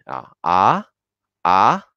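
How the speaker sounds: "Ah" climbing steeply; rising tone two. Shown as a demonstration. Teacher repeats tone two for learners, rising "Ah". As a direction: start "Ah" mid and sweep it upward. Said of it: "luego subir".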